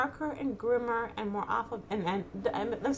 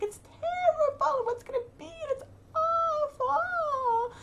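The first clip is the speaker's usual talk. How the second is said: high, slow, crying whine; mock wailing distress; 4 dB louder, slower than usual. Parodying her own gloomy thoughts with a high mock wail. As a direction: wail it like the world is ending.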